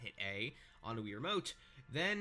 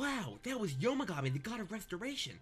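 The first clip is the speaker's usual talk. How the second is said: excited; 8 semitones higher than usual. Acted. Mock excited character reading.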